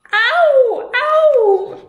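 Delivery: very high, loud yelps; pained scream. Acted. Giant screams after biting the stone bun. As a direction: yelp from a broken tooth. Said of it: "screamed the giant".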